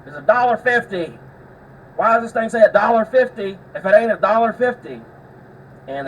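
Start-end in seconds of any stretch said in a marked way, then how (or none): 0.1-5.0 mock the guy yelling at the cashier; loud, higher-pitched indignant complaint; angry yelling. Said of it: "yelling at the guy in the front".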